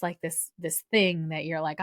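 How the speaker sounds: usual speaking voice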